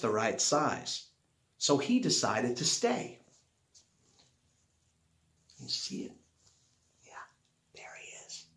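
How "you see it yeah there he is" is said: whispered aside. Real, not acted. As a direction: lean in and whisper. Aside to child while reading.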